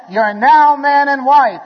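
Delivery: high, loud, slow pronouncement; ringing officiant's declaration; acted proclamation. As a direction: proclaim it slowly to the whole church.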